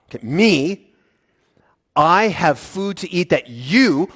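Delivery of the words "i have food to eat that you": I and YOU punched, slow; emphatic. Shown as a demonstration. Preacher voicing Jesus contrasting himself with his disciples, emphatic. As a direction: lean hard on "I" and "you". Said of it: "also emphatic position".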